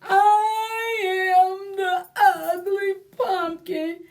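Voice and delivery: high, slow, whiny pumpkin telling its woes; storybook lament, high and drawn out; sad pumpkin voice. Acted. Reads picture book in sung-out pumpkin voice.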